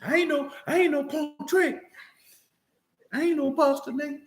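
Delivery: high mocking tough-guy parody with laughs; mocking her words. Teasing his partner by mimicking her boast. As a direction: mimic her claim, hammed up. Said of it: "And I cry about real things".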